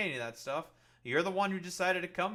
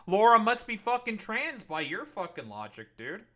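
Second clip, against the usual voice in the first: mock outrage; 4 semitones higher, 10 dB louder than usual. Mocking chat's logic, shouting.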